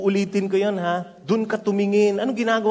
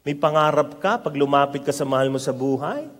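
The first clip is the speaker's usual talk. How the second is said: ordinary talk, nothing marked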